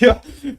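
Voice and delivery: quick high giggle; short high laugh. Real, not acted. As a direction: giggle sharply. Laughing at his own logic.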